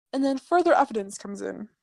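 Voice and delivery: ordinary delivery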